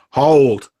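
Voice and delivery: shaman stopping his warriors, a sharp loud shout; shouted command. Acted. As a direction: halt them with one shout. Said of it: "their shaman shouts".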